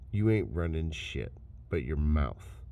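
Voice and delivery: normal talking voice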